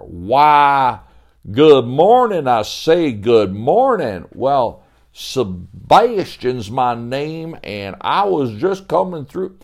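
a visitor greeting grandly at the door; folksy old-timer Sebastian. Acted. Sebastian voiced.

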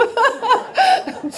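high, bright burst of laughter; delighted laugh. Real, not acted. Teller laughing at her upgrade to first class, delighted. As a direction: laugh out with relief.

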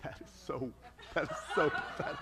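low, breathy scoff under your breath; half-laughing, dismissive mutter. Retold or imitated. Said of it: "I was like".

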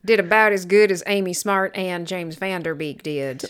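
slow, drawn-out West Texas drawl; West Texas accent. Pretend. Defending the accent to co-host, mock West Texas accent. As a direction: lay on a bad Texas accent, slowly. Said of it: "do a West Texas accent".

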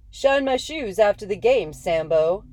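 loud jeering yell. Acted. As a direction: shout it across the field. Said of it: "one of the Phillies yelled".

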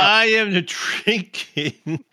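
laughing through the words. Real, not acted. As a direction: giggle while you say it. Laughs mid-word while answering.

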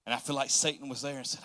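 ordinary talk

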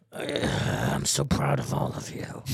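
slow, low whispered praise; a producer whispering to his boys; mock Harvey Weinstein whisper; whispered mogul. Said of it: "Harvey probably sat them down and just goes".

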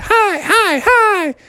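very high tiny greetings; little cub voices. Acted. The three bear cubs greeting the frogs, very high little voices. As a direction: squeak hi like small kids.

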